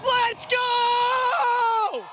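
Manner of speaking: high, drawn-out victory yell; real excited shout. Real, not acted. Race winner yelling to the trackside reporter, ecstatic. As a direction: yell it out in triumph, stretching the last words. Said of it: "We love your excitement".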